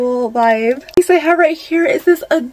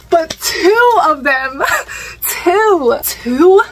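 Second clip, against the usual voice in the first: slow, higher, laughing two; delighted disbelief; 3 semitones higher, much slower than usual. Real, not acted. Showing viewers two bags, giddy disbelief. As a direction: savor it, half laughing in disbelief.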